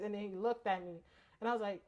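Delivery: ordinary talk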